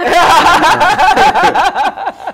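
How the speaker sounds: a hearty cackle that breaks out; loud burst of laughter. Real, not acted. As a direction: laugh out loud, carried away. Nervous story told with laughter.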